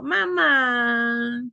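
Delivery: put-on child's "Mamá" with the last syllable stretched long; drawn-out last syllable. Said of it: "alargan la, la sílaba última". ("Mamá" con la última sílaba alargada, como niño que pide)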